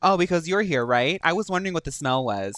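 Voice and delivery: loud, quick mock-offended retort; sassy comeback. Player answering a rival wizard's taunt, sassy comeback. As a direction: fire the insult straight back, loudly.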